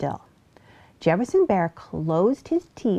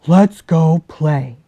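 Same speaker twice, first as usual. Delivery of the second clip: loud, gruff big-bear voice; a big bear sending the fox away, loud and grumpy; 3 semitones lower, 9 dB louder, slower than usual. Acted. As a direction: shoo her off like a cross old bear. Bear voice in story.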